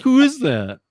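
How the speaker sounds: imitating a support agent who doesn't know his boss; mock clueless question. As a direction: play the agent as baffled.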